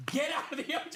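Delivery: high-pitched mock alarm; alarmed plea. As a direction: call out in appalled disbelief. Acted plea to swimming kids.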